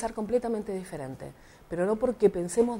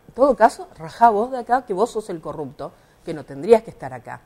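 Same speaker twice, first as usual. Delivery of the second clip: heated comeback; 8 dB louder than usual. Retold or imitated. Retelling how she told the official to get out himself, heated and louder. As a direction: throw it back at him, then settle.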